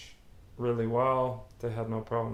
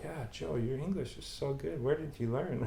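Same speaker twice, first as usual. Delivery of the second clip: higher, softer mock of the intern's praise; imitating the intern; 4 semitones higher, 7 dB quieter than usual. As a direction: lightly mimic her gushing compliment. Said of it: "and she's like".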